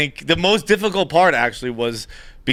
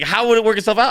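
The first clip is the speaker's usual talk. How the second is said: higher-pitched mock incredulous question; exasperated disbelief; 8 semitones higher, 4 dB louder than usual. Super challenges tenant's plan, mock exasperated. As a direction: imitate a baffled super, pitched up.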